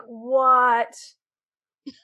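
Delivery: drawn-out, pausing outburst rising high and loud at the end; retold stunned disbelief. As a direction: stretch it out in stunned disbelief, bursting on the last word. Retold shocked reaction to a twist.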